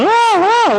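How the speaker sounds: high, loud mock howl of pain; imitated pain howl. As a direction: wail like a patient in agony, hammed up. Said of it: "a pessoa tá lá urrando".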